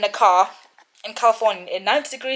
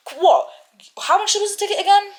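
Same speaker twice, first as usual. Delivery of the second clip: much higher, fast, exasperated question; incredulous outburst; 12 semitones higher, 5 dB louder than usual. Real, not acted. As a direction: fire it off in disbelief. Indignant about the story.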